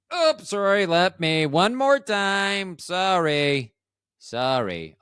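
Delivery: drawn-out, exaggerated apologizing, high at first then sinking; mock bedroom apology. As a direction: plead for another go in a hammed-up, stretched voice. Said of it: "which sounds like me during sex".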